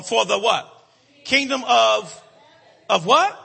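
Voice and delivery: loud prompting. Real, not acted. Preacher prompting the congregation to finish the verse, loud prompting. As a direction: loudly call for the answer.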